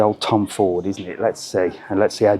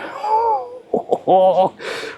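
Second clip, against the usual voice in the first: a sudden high-pitched burst of laughter. Real, not acted. Laughing at planning a Yellowstone trip for a Stetson, high laugh. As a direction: laugh up high at your own joke. Laughing at own holiday plan.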